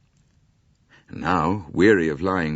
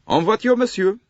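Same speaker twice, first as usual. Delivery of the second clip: high, loud French call to board; a conductor urging the passenger aboard in French; high, loud French conductor's call; 6 dB louder than usual. A narrator's reading. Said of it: "said the wagon-lee conductor".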